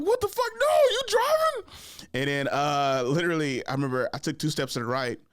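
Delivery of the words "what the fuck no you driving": very high, loud mock disbelief; alarmed outburst. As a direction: shoot the voice up in appalled protest. Acts out alarmed reaction to driving.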